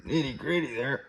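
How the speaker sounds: straining while pushing hard; words squeezed out with effort; effort grunt. Real, not acted. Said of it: "Sorry for the grunting".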